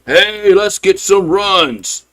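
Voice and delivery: high, drawn-out ballpark holler; rally the crowd like an old-time announcer; Harry Carey rallying cry. Acted. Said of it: "That's my Harry Carey, uh, impersonation".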